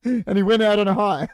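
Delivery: real laughter through the words; chuckling through the line; laughing. Real, not acted. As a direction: laugh while saying it.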